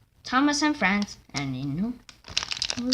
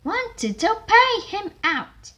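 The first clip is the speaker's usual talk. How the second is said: loud character line; 13 semitones higher, 7 dB louder than usual. Acted. Engine character shouting.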